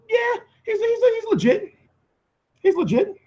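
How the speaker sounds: squeaky falsetto agreement; put-on high voice, playfully affirming; high-pitched assurance. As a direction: say it way up high, insisting. Answers whether AJ is real deal.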